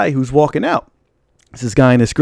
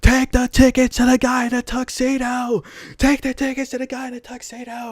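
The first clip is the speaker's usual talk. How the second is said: yelling from afar; 9 semitones higher, 4 dB quieter than usual. Retold or imitated. Stranger yells back while walking out, mock far-off holler. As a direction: imitate a distant holler, held back in volume. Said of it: "he's, like, yelling".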